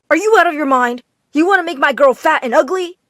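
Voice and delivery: angry father. Acted. Father yelling at son.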